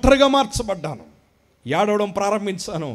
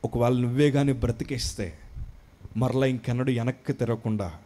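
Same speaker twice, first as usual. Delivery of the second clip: hushed humble prayer; 6 semitones lower, 5 dB quieter than usual. Retold or imitated. Retold humble prayer near death.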